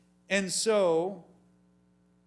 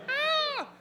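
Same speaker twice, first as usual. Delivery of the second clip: sudden squeal of fright, pitched way up; high mock shriek; 4 dB louder than usual. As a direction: yelp like a startled listener. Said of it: "You're like".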